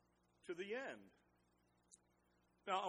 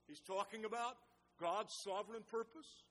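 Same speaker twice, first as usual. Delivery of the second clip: ordinary delivery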